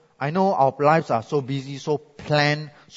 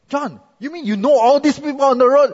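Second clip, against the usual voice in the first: higher, louder incredulous ask; amazed question; 8 semitones higher, 5 dB louder than usual. Retold or imitated. Pastor asking his African friend about honking, amazed. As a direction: sound stunned by the honking.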